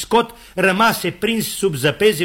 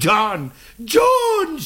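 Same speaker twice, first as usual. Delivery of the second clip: high drawn-out call, climbing on the repeat; 9 semitones higher than usual. Acted. Scott searches for his friend, high long calls. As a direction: call again for a lost companion. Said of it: "Și iar Scott".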